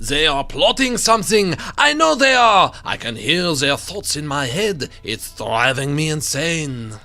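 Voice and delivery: hammed-up, paranoid French painter; French accent. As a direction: put on a rough, theatrical French accent. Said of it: "It's my best French accent".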